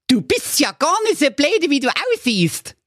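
very high, grudgingly approving dog; cheeky backhanded praise; Doktor Schulz. Acted. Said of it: "sagte der gestiefelte Köter anerkennend". (anerkennender Hund, sehr hoch)

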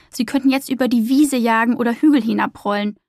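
ordinary delivery, nothing marked

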